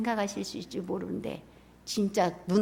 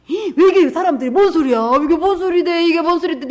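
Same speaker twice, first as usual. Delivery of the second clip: blind men clamoring; 7 semitones higher, 11 dB louder than usual. Acted. Blind men asking what the noise is.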